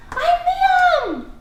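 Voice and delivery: very high, loud excited cry; excited shout. Shown as a demonstration. Teacher modelling exclamation again, excited shout. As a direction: burst out with it. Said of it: "say it excited or yelling".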